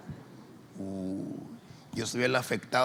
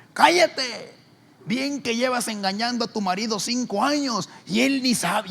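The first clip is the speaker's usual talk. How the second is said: much higher, louder angry retort; shouting back; 11 semitones higher, 7 dB louder than usual. Retold or imitated. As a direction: fire it back.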